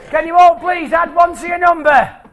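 put-on shouting for the elderly. Performer repeats instruction for old people in audience, loud shout.

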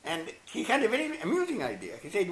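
ordinary talk

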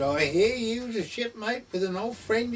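high, put-on voice of the bishop, a touch imitating; retold bishop's voice. Bishop asking the vicar about his old shipmate, high imitating voice.

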